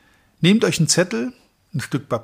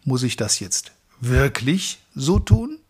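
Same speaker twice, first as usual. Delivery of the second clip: wirklich set apart and drawn out, slow; pause, then lean hard on wirklich; slow question with heavy weight on wirklich; stress on wirklich. Shown as a demonstration. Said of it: "ist eine andere Aussage als".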